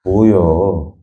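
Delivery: low, drawn-out mock annoyance; comic Chungcheong irritation, pitched low and stretched; acted irritation. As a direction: let it sag, as if fed up. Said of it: "짜증나면 어때요?".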